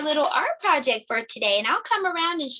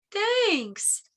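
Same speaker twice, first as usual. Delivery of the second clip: a touch of a bear saying thanks; lightly voiced bear, falling; 6 semitones higher than usual. Teacher voicing Bear thanking Hare.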